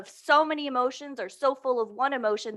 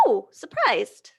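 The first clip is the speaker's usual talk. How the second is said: surprised; 2 semitones higher, slower than usual. Shown as a demonstration. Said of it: "Not happy, more".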